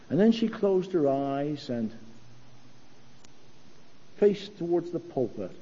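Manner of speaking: ordinary talk, nothing marked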